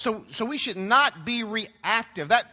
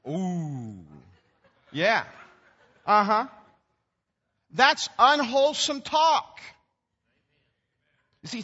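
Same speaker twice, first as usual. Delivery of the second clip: ordinary talk, nothing marked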